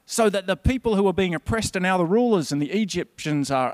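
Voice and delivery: usual speaking voice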